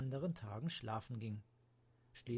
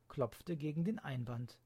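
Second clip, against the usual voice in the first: ordinary delivery, nothing marked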